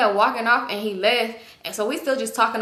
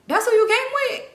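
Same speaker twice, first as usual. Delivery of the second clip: much higher and louder disbelieving question; shocked disbelief; 6 dB louder than usual. Retold or imitated. Retelling a guy shocked at who she came with, disbelieving. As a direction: react like you can't believe it.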